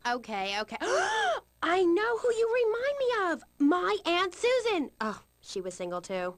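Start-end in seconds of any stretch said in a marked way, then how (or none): 0.8-1.4 sharp high-pitched gasp; gasp of realization. Acted. Host has sudden realization. 1.6-4.9 high, gleeful exclamation; delighted high-pitched discovery; excited realization. Acted. Host's sudden gleeful realization.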